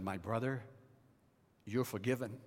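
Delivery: soft, low and gentle; tender forgiveness. Retold or imitated. Pastor forgives weeping elder.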